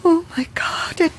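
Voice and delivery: slow, breathless whisper of fear; frozen in fear, barely breathing; terrified whisper. Acted. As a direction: whisper as if it might hear you. Terrified at the window.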